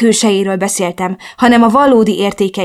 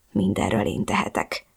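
very low, soft self-blame; muttering to oneself; 12 semitones lower, 10 dB quieter than usual. A narrator's reading. Woman in tears repeating her guilt to herself, muttered. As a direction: murmur it privately, barely voiced. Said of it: "Hajtogattam magamban".

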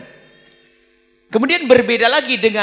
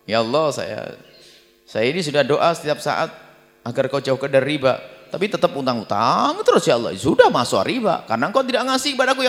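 insolent complaint; 6 semitones lower than usual. Retold or imitated. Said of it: "ini kurang ajar kepada Allah".

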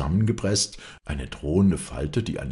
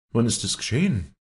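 urgent, anxious question; 8 semitones higher, 4 dB louder than usual. A narrator's reading. Walli asks urgently.

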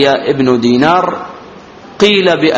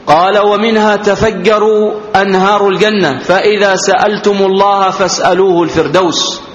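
higher, sustained reading tone; formal hadith recitation; 5 semitones higher than usual. Acted. Teacher reads the Prophet's words to students, formal recitation. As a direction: recite the text formally on a raised pitch.

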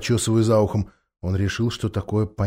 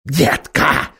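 hissing; 3 semitones higher, 5 dB louder than usual. Acted. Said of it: "прошипел он".

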